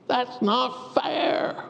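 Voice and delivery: slow, drawn-out whine; whining. Acted. Preacher voicing everyone's whine in hard times, slow. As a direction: drag it out like a pouting child. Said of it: "when we're whining, that's what we say".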